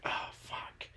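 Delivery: hushed unvoiced curse; a dad whispering, caught off guard; dad's whisper. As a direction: whisper it in a dark bedroom. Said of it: "he's like".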